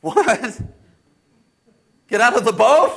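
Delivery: startled, rising questions; incredulous. Acted. Disciple told to come out onto the water, incredulous. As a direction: question it in disbelief, rising at the end.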